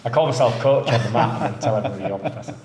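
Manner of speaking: normal talking voice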